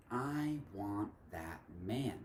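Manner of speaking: slow, soft and deliberate; hushed solemn quote. Acted. God looking down on his enemy in the poem, hushed and slow. God's words voiced within the poem.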